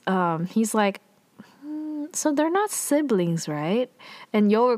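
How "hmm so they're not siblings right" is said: high musing hum, dropping on the last word; suspicious. Retold or imitated. Hidden onlooker watching the pair from behind, suspicious. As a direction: imitate someone piecing it together with suspicion. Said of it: "pretty suspicious".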